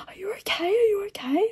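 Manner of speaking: high, worried mock-diner voice; concerned diners. Diners asking the fallen vlogger if she's okay, high worried mock voice. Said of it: "the whole restaurant was looking at me like".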